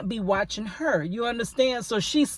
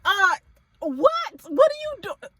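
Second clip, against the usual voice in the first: very high stunned what; outraged incredulous squeak; shocked disbelief; 11 semitones higher than usual. Retold or imitated. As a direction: caught off guard, voice shooting up. Being walked to the door.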